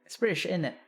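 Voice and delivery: tentative put-on British; attempted British accent. Shown as a demonstration. Streamer checking with chat, in attempted British accent. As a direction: tag question in an amateur British accent. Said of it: "Is that British?".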